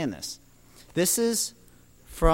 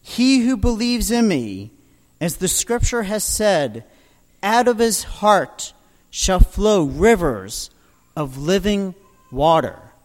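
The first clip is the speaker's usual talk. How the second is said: proclaiming; 2 semitones higher, 6 dB louder than usual. Retold or imitated. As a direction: announce it to the whole crowd. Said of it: "and proclaimed".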